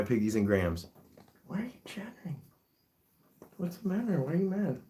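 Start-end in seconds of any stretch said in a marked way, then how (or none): 1.5-2.4 high breathless whisper to the pig. Real, not acted. Owner whispering to her chattering guinea pig, high whisper. 3.6-4.8 pet talk. Real, not acted. Owner soothing her cranky guinea pig, high cooing pet voice.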